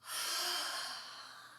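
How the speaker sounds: long, voiceless sigh out; breathy exercise exhale. Real, not acted. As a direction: let a long breath rush out.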